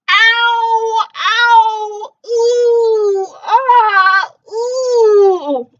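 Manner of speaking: high, loud pained wails; loud monster groans of pain; monstrous moaning cries. Acted. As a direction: howl like a sick monster. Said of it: "what was causing the monstrous noise".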